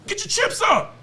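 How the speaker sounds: high, loud yell of the words Get your chips up; shouted command. Real, not acted. Host shouting at artists to invest, loud yell. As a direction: bark Get your chips up at the whole room.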